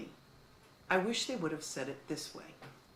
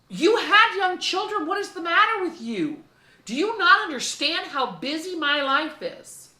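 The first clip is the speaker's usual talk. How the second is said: exasperated younger self; 11 semitones higher, 13 dB louder than usual. Retold or imitated. Her younger self scoffing at her parents, exasperated. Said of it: "And I remember thinking".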